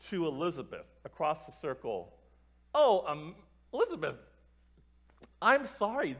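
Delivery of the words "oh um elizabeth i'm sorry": higher, hesitant retold outburst; blurted out. Girl blurting sympathy to Elizabeth across the circle, haltingly. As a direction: imitate someone blurting an awkward apology. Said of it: "Amanda blurts out".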